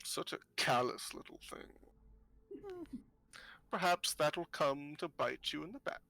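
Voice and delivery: put-on hushed old seer's veiled warning, trailing into breath; disapproving old fortune-teller. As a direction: warn them quietly with knowing disdain.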